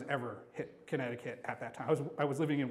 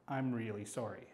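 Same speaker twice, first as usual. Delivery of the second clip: low, soft, insistent retold apology; solemn apology; 7 semitones lower, 8 dB quieter than usual. Coworker insisting on apologizing at the desk, quiet and solemn. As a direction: lower the voice and mean it.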